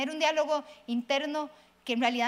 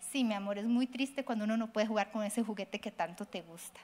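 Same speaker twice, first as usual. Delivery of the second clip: softer, lower, soothing reply; tender mother; 6 semitones lower, 5 dB quieter than usual. Shown as a demonstration. Mother answers her upset child, soft and soothing. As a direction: comfort the child gently. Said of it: "por ejemplo".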